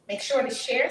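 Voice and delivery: ordinary delivery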